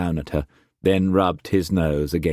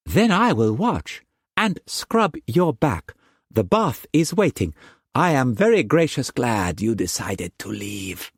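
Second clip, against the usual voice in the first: exuberant chatter; 6 semitones higher than usual. A narrator's reading. Mei Mei chattering to Struan as he bathes, high. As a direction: chatter on happily. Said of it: "chattered on exuberantly".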